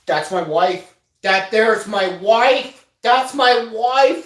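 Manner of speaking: slow, loud, rising "that's my wife"; lovestruck declaration. Retold or imitated. Boy seeing his crush score, slow loud smitten boast. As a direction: savor it, smitten and proud. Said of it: "I'm like, oh".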